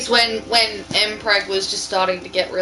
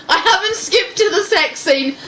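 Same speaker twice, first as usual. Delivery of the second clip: laughing while talking; 9 semitones higher than usual. Real, not acted. Laughing while reading smut.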